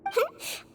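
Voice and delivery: bright little giggle; quick amused giggle; light giggle. Acted. Doctor amused by the request.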